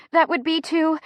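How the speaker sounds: high, strained fragment; pained. A narrator's reading. Chrissy in contraction answers the driver, pained. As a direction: push it out before a breath. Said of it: "deep breath".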